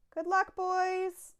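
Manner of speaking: called out loud and high, slow; cried out. A narrator's reading. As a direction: Onceler calling farewell to his barbaloots, loud and slow. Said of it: "he cried".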